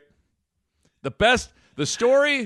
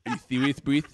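put-on lisped Nigerian accent, lower and incredulous; Manny Gaku; 3 semitones lower, 4 dB quieter than usual. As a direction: imitate a Nigerian man's heavy lisp. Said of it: "spoke with a Nigerian lisp".

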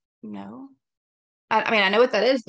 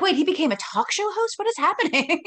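surprised; 8 semitones higher, 3 dB louder than usual. Retold or imitated. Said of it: "I was like".

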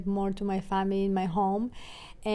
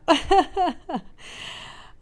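teary laugh; 9 semitones higher, 4 dB louder than usual. Real, not acted. Laughing through tears.

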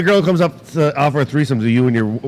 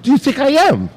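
higher, indignant retold question; 9 semitones higher than usual. Husband asking a client to guess his age, indignant. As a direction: ask it, stung and incredulous.

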